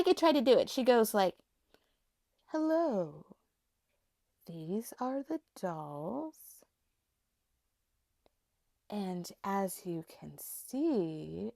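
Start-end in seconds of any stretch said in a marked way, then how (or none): 2.5-11.5 slow, careful, slightly lowered put-on voice; imitating a family member's slow, affected voice; relative's put-on voice. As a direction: drag each word out to sound a little deeper. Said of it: "lower your voice all the time and speak very slowly".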